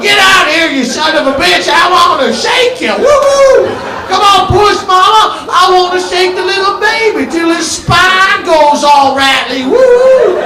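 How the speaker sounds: frenzied high shouts with whoops; manic shouting. Retold or imitated. A new dad yelling at the birth, manic and whooping. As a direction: whoop and yell like a crazed father.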